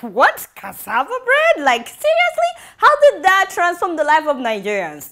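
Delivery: high, loud disbelief; can't believe what she's reading; incredulous. Retold or imitated. Mocking the report's cassava bread claim, loudly incredulous. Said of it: "I was like".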